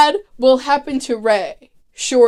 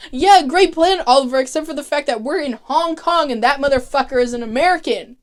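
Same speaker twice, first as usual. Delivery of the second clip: sarcastic retort; 5 semitones higher than usual. Retold or imitated. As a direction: roll your eyes at a dumb plan. Sarcastic friend mocks a bad plan.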